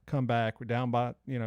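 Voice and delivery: ordinary talk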